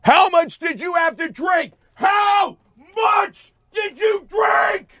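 high furious screaming; shrill screamed rage, slow and drawn out. Retold or imitated. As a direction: scream the question at the drunk driver. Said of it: "I start screaming at her".

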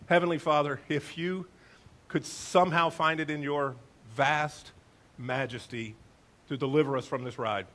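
hushed formal prayer to God; solemn prayer. Retold or imitated. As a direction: pray it earnestly under your breath. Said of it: "And I said".

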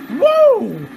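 loud, high celebratory woo; victory whoop. Real, not acted. Celebrating a win in game.

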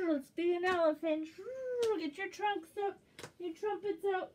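put-on rally the kids into the elephant; excited. Teacher cheering kids into elephant play.

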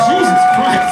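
very high, loud fed-up shout; exasperated yell. Real, not acted. Comic scolds audience talking through the show, loud exasperated shout. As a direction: blow up at the chatterers.

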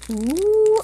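ordinary talk, nothing marked

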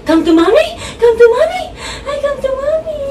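sing-song call rising to a squeal, coaxing the dog over. Retold or imitated. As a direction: climb up in pitch as you call the dog. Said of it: "pag sinabi ko na".

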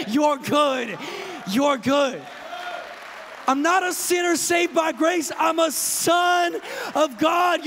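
high, loud, building sermon delivery; full-force preaching. Real, not acted. Preacher declaring freedom to the congregation, full-force preaching. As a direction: preach it out, rising to a shout.

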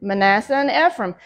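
ordinary delivery

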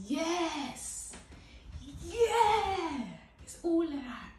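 loud, high celebratory yell; excited cheering. Real, not acted. Vlogger hyping their voluminous hair, excited cheering.

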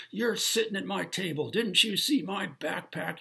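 high, loud indignant demand from a rude stranger; retold diner scolding a stranger for taking his table; angry stranger. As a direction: play the offended guy claiming his seat.

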